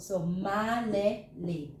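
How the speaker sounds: malilate split into syllables, slow and even; slow syllable-by-syllable Tagalog. Shown as a demonstration. Teacher drilling the word for learners, slow and deliberate. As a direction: say malilate as ma-li-let, short e. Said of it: "we read it bisyllable".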